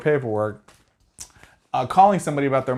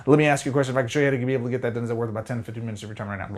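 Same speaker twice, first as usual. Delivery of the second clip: rushed script read, much faster as it goes; racing through the script; much faster than usual. Shown as a demonstration. Rushed caller racing through a script to Mister Johnson. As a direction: rattle it off to get to the end. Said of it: "it's a race to the end".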